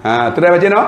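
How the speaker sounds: usual speaking voice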